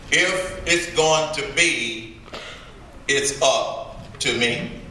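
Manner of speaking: motto spaced out slowly, phrase by phrase; slow, deliberate repeat. Shown as a demonstration. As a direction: let each phrase land, unhurried.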